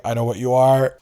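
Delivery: hammed-up accusing dog meme; loud knowing meme accusation. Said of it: "homophobic dog meme being like".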